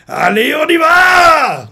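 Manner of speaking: high, loud, slow shout; battle cry. Acted. Commander ordering his unit forward, slow shout. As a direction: launch the charge at full voice.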